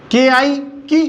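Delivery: के आई की spelled slowly and evenly; slow classroom spelling. Shown as a demonstration. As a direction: sound out each letter slowly. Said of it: "बस हल्का की".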